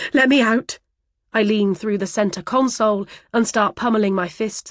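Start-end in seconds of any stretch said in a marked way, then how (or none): 0.1-0.8 loud, high demand to be let out; shouted demand. Acted. Trapped passenger shouting at the driver to let her out. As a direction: bark it as an order.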